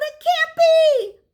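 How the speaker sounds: high, loud anguished cry; wail. A narrator's reading. Boy finds his garden trampled, wailing. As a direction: wail it out in despair. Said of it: "Luke wailed".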